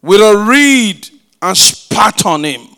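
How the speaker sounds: loud, forceful scripture reading; preaching scripture. Acted. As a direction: proclaim the passage to the whole church. Reads scripture at full force.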